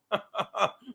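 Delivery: rising chuckle after a joke. Real, not acted. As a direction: laugh at your own joke. Laughs at own disco joke.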